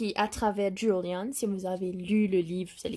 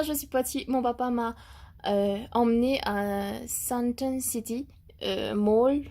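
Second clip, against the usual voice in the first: normal talking voice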